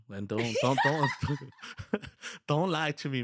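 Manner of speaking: half-laughing, emphatic telling-off; laughing warning. Retold or imitated. Boss tells new painters not to lie, laughing warning.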